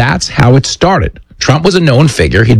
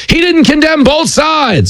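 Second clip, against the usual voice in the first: slow, much higher sneering whine; mocking quote. Host mocking a Democrat talking point, slow high sneer. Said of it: "Remember the old".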